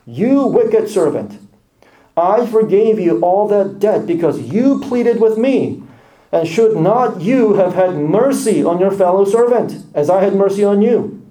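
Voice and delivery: the master scolding his wicked servant with stern anger; angry master. Acted.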